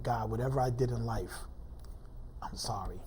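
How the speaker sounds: soft plea, sinking almost to a whisper; retold prayer. Retells praying on his knees.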